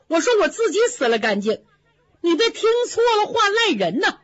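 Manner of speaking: quick, laughing and pitched high. Acted. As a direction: laugh the blame away, talking fast. Baoyu laughs off a quarrel.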